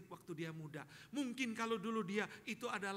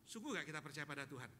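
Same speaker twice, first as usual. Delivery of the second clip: normal talking voice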